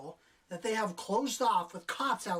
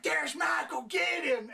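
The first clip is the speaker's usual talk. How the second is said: high, louder imitation of a cop's alarm; mock urgent shout; 9 semitones higher, 4 dB louder, slower than usual. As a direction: shout it like a hammed-up pursuit order. Mimics cop calling out.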